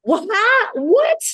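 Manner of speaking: very high, loud incredulous squeak; hammed-up shock; stunned disbelief. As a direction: act utterly flabbergasted, voice shooting up. Reacts to the impossible thanks.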